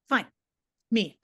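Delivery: ordinary delivery, nothing marked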